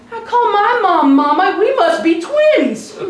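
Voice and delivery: high, loud, drawn-out punchline; overjoyed mock astonishment. Dad joking with a little boy, mock astonishment. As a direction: stretch each word in hammed-up excited discovery.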